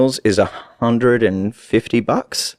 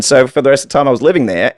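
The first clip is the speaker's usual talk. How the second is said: ordinary delivery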